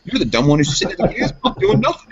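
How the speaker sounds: high-pitched exasperated scolding, retold; retold yelling. Yelling at Adam about the gas pump, retold scolding. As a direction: tell him off again, voice climbing high. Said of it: "I'm yelling at him".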